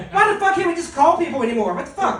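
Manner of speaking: put-on grumbling like an old-school dad fed up with texting; hammed-up irritated father; imitating grumpy dad. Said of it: "who still gets mad at his damn phone".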